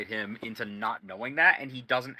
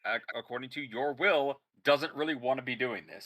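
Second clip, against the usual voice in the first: ordinary talk, nothing marked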